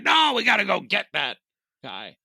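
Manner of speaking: high-pitched, insistent obsessed cop. Acted. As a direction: push it urgently, like a cop fixated on his man.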